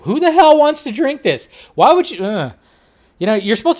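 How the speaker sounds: high, loud shocked protest; outraged disbelief. Real, not acted. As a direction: cry out in disgust at the drink. Reacting to Flaming Hot Mountain Dew.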